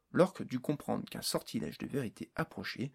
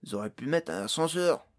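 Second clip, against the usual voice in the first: loud character grumble, a bit higher; grumbling; 4 semitones higher, 6 dB louder than usual. A narrator's reading. Jason griping about the endless stairs, grumbling. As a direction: grouse loudly about it. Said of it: "grogna Jason".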